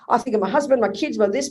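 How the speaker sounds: normal talking voice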